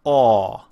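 loud, resonant "aw" with oval lips; rounded aw vowel. Shown as a demonstration. Teacher repeats the sound for learners, loud rounded "aw". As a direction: hold an open yet rounded "aw". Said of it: "again, is".

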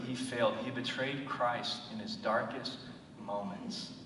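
ordinary talk

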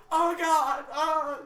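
loud, high panicked cry; mock panic. Classmate panicking over the assassination contract, loud high yelp. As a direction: yelp it in fake alarm. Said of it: "He's like".

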